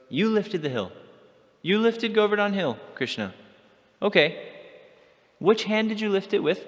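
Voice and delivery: witty friend setting a trap for Krishna; fiery teasing. Retold or imitated. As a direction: needle him with a sly trap question. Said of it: "because she's witty, she's quick, and she's fiery".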